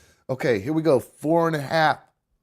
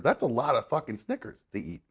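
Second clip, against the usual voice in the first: usual speaking voice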